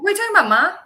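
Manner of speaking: high, loud Southern protest; Southern accent. Retold or imitated. Child argues back to mom, high Southern accent. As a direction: whine at Ma in a Georgia accent. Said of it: "I would have a Southern accent".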